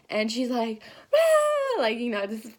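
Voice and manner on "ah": imitating the sister's high, loud shriek of joy; excited scream. The sister screams at the news from Taylor Nation. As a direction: let out a piercing squeal. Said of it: "loses her shit completely".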